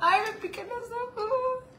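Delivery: high, slow teary voice; crying. Real, not acted. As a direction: speak through real tears. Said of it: "El llanto icónico de la [name]".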